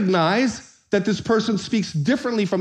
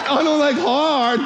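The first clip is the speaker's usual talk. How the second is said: mock shout; 7 semitones higher, slower than usual. Shown as a demonstration. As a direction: belt out "I don't like hard" in protest. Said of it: "got lost in the translation".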